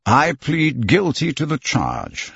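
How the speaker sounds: slow, measured statement with falling end; composed confirmation. Acted. Accused confirms his plea to the judge, composed. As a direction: confirm it evenly and deliberately.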